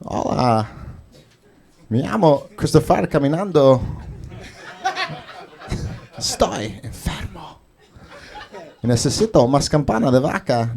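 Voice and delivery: halting Spanish with odd, long pauses; swooping pitch, stop-and-start delivery; Christopher Walken impression. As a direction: do a famous actor's quirky cadence in Spanish. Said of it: "This is Christopher Walken if he spoke Spanish".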